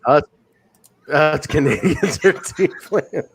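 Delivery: slow, breaking up with laughter; laughing quote. Retold or imitated. Podcaster repeating a wrestler's line, slowly through laughter.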